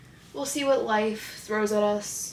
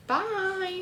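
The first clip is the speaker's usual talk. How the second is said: sing-song goodbye; 9 semitones higher, 4 dB quieter than usual. Real, not acted. Sing-song vlog sign-off.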